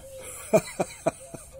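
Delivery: staccato lion chuckles; lion laughing. Acted. Lion amused by mouse's promise, hearty laugh. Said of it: "The lion laughed again".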